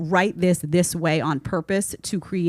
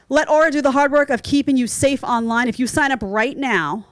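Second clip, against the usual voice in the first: ad read; 6 semitones higher, 4 dB louder than usual. Acted. Ad read, brighter sell voice.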